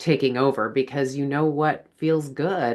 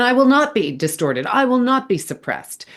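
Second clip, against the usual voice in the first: higher and louder, firmly stressing NOT; defiant declaration; 9 semitones higher, 4 dB louder than usual. Real, not acted. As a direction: declare it like a vow of resistance. Defiant declaration against suppression.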